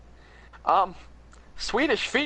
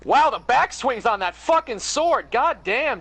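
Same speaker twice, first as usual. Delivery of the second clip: excited shout; 8 semitones higher, 5 dB louder, slower than usual. Real, not acted. Big sword hit in game.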